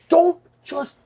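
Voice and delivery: very slow, high, stretched-out command; yelling drill instructor. Retold or imitated. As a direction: imitate a drill instructor drawing out every word. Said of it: "they're all yelling with their hats".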